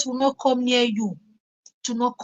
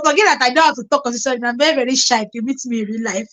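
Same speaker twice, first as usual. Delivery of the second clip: fast aside; much faster than usual. Real, not acted.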